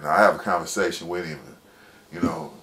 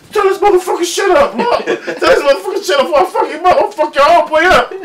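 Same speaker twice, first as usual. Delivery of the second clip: much higher and louder threatening yell, laughing; furious shout; 12 dB louder than usual. Retold or imitated. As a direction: yell the threat across the cell. Homie standing up threatening.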